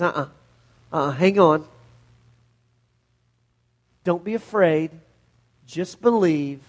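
steady the frightened father gently; gentle reassurance. Acted. Jesus calming the grieving father, low and reassuring.

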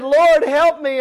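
storyteller's imitation of a desperate prayer; anguished cry. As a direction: cry out for help, lightly hammed up. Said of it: "in anguish, she cried out".

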